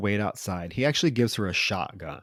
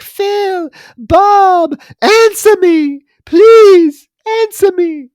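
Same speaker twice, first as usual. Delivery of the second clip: high, loud mock screaming plea; imitated scream; 14 dB louder, much slower than usual. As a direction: shriek the names like a horror-film heroine. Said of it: "where she, she gets to scream".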